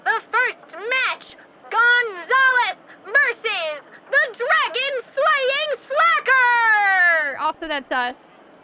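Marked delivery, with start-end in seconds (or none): 0.0-7.4 big booming slow ring announcer; fighting game announcer. Shown as a demonstration. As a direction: stretch each name out for the crowd. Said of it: "I can say this in my announcer voice".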